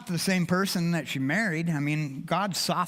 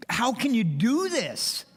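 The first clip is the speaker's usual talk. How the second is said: higher, slow, pleading question; slow raised anguished plea; retold plea to God; 6 semitones higher than usual. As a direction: plead it slowly, up high, like asking God why.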